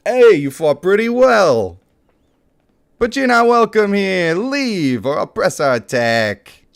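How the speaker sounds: a proud ranger turning strangers away; stern ranger. Acted.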